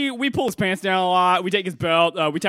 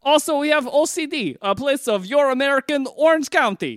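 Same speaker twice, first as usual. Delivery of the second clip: put-on higher-pitched foreign accent, broken English; foreign show host; 5 semitones higher than usual. Foreign guest who never came to America, higher accented voice. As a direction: talk like an eager visitor from abroad.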